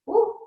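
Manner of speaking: surprised woo then a laugh; startled laugh. Real, not acted. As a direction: whoop as you wobble, laughing. Wobbles, nearly loses balance.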